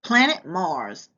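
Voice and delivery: ordinary delivery, nothing marked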